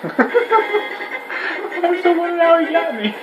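high-pitched laughing speech. Real, not acted. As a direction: talk through the laughter. Laughing after the round.

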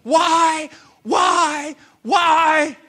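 loud, high cries of pain; anguished shout. Retold or imitated. Preacher reliving crying out in pain in Peru, shouting. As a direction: hurl each word up in agony. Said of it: "and I'm going".

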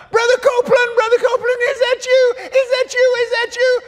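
high falsetto woman calling out; imitating pastor's wife. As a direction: call out in a high put-on woman's voice. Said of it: "I heard pastor's wife".

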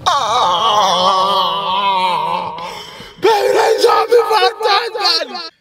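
high, slow wailing sobs; fake crying. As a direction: wail like you just got shot. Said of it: "that's me practicing".

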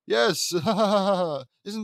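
loud, high, gleeful laugh. A narrator's reading. Bossy little sister laughing at her jester, loud and gleeful. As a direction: cackle with delight at the joke. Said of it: "As Lola laughs at the fun".

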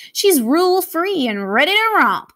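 furry monster bellowing about Mia; bellowed. Acted. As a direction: boom it out proudly. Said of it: "bellowed a furry monster".

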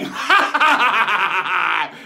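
loud, long staccato guffaw; pirate laugh. Acted. Storyteller cracks up at his own punchline, pirate guffaw. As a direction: roar with hearty pirate laughter.

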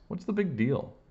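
put-on high-pitched, dismissive kid; mildly defiant kid's retort. A narrator's reading. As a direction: shrug it off with pitch lifted. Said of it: "rolling his eyes".

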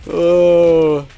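loud, high, sustained groan; pained moan. Real, not acted. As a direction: moan with a broken heart. Mourning a broken heart.

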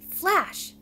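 high, loud voiced hiss of warning; alarmed hiss. A narrator's reading. Bongo popping out to scold Flash, alarmed hiss. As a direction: snap the name out in alarm. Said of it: "she hissed".

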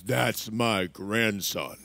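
very low, slow, quiet authority; big cowboy grandpa. Retold or imitated. Texan grandpa facing down the referee, low and slow. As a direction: drop deep and slow like a towering cowboy. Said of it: "think John Wayne".

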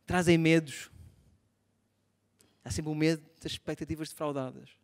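ordinary delivery

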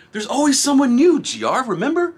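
high and loud, calling it out; hyped reminder to himself; excited catchphrase. Shown as a demonstration. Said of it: "There's always someone new, right?".

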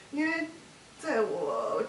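loud, overwrought outburst; distressed, halting between loud bursts; real distress. Real, not acted. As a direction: push each phrase out loudly, upset. Upset, interviewer tells her to stop.

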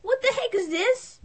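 shocked cry of disbelief. Acted. Owner finding the dead pet, shocked. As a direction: gasp out the question in horror.

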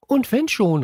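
mild high-pitched exclamation; defiant call. A narrator's reading. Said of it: "rief Pink".

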